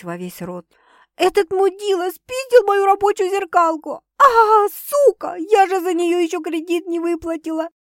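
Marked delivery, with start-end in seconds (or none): 1.2-7.7 high, hysterical mimicry of a friend; comic squeaky impression. Sister mimicking their friend's meltdown, squeaky hysterics. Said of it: "комично запищала Светка, подражая истерике подруги".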